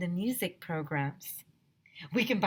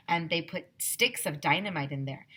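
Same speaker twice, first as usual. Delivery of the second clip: usual speaking voice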